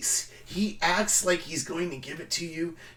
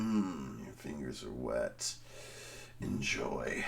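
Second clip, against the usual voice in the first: much lower, much softer sultry murmur; 12 dB quieter than usual. Retold or imitated. Comic whispering to the woman beside him, low and sultry. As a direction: purr it low and slow into her ear.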